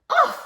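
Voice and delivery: high, loud "ugh" of disgust; disgusted cry. A narrator's reading. Dusty Locks sinks into the bed, crying out. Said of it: "cried Dusty Locks".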